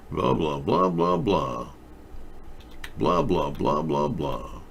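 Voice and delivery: dismissive blah. Acted. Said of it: "dismissing the history talk".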